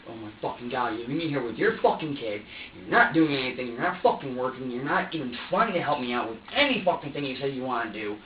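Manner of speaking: pissed-off accusing tirade with loud punched words; angry rant. Retold or imitated. Ranting at her for leaving him with the kid, angry. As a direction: build into a resentful tirade.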